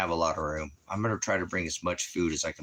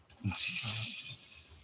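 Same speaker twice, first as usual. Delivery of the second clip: breathy laugh; 3 semitones higher than usual. Real, not acted. Laughing at the dog.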